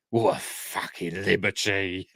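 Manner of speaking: put-on comic old granny voice, slow; Nan. Host quoting comedian's granny catchphrase, slow put-on granny voice. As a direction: do an outraged sketch-show granny, drawn out. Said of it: "when she does Nan".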